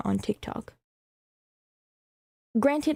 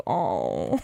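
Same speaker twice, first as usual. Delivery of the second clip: sympathetic aw. Real, not acted. Pity for apologetic creator.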